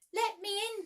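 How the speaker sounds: high, loud urgent cry. A narrator's reading. Tink crying at Peter's door, high and loud. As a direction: shout to be let in now. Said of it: "cried Tink".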